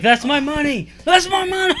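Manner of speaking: hammed-up yell, pitch climbing on the repeat; mock furious yell. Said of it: "Volchek's like".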